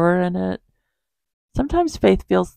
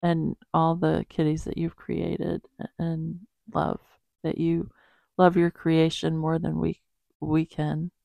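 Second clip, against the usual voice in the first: soft, unhurried praying voice; quiet prayer; 3 dB quieter than usual. Acted. Praying aloud for animals and the world, soft and halting. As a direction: pray gently, pausing to find the words.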